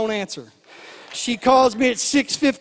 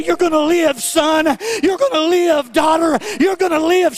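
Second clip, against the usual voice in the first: higher, louder preaching of each line; loud proclamation; 5 semitones higher, 4 dB louder than usual. Acted. Preacher calling life over the people, loud and fervent. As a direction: declare it over them with full force.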